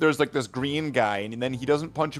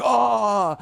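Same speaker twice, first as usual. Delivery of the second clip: high yelp of pain, hammed-up; mock pain yelp; 9 semitones higher, 5 dB louder than usual. Villain punched in the dick yelps high. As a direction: squeal like you just got punched. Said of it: "and they're like".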